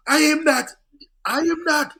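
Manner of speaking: high, loud acted-out cry; loud, high-pitched hollering imitation; excited calling out. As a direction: call it out like an excited local spotting a star. Imitates excited Jamaicans leaving their posts.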